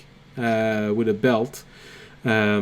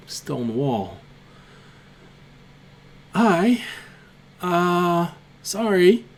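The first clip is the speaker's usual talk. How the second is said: lightly voiced small kobold, dazed then apologetic; a hint of a flustered little creature rubbing its head; nervous kobold; 3 semitones higher than usual. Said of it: "He looks at you and says".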